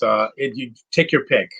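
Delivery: normal talking voice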